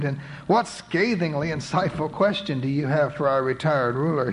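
ordinary delivery

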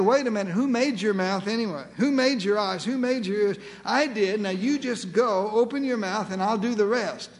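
ordinary delivery

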